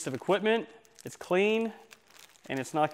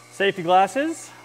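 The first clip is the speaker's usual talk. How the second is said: raised voice over loud machine; shouting over table saw; 5 semitones higher, 9 dB louder than usual. Real, not acted. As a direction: call it out over the saw noise. Saw running, calling over noise.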